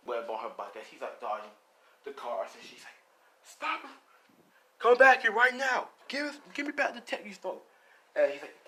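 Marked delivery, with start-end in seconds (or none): 3.4-7.6 high, urgent yell after someone; acted angry demand; shouted command. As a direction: call out loudly, pitched way up. Heroine yelling after the thief.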